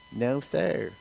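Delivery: soft, sullen no sir; disrespectful tone. Shown as a demonstration. Child back-talking father, in a sullen tone. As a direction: say it with attitude to the parent. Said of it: "It's tone".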